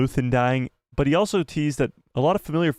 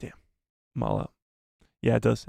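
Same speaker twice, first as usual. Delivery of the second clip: ordinary talk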